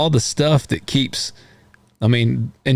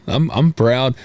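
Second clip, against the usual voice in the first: usual speaking voice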